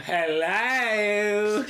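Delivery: high drawn-out Aussie "Hello" in a hammed-up imitation; bad Aussie accent. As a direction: ham up a terrible Aussie greeting. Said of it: "That's my favorite Aussie accent ever".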